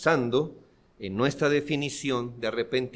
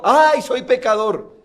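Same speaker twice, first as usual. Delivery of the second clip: louder, higher mock sigh of a casual sinner; a shrugging sinner; 8 semitones higher, 13 dB louder than usual. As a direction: parody the easy excuse. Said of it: "Él no dijo". (imitando con burla al que se excusa)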